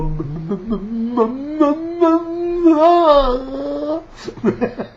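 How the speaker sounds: high squealing laugh breaking through; laughing fit. Real, not acted. Laughing at the people who blame him. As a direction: cracks up mid-rant. Mocking the mask accusation.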